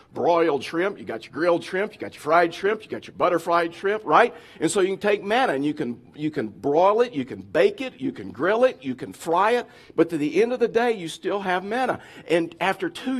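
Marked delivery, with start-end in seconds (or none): none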